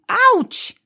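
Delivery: loud, high yelp of pain; sudden high ouch, shouted; pained cry. A narrator's reading. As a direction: yelp as a hurt little badger. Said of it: "krzyknął Borsuczek".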